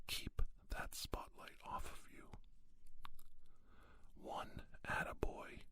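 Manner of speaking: ordinary delivery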